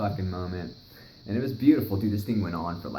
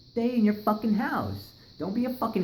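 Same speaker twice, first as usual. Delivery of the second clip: emphatic warning; 13 semitones higher than usual. Real, not acted. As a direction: drive the warning home. Repeats warning to listeners.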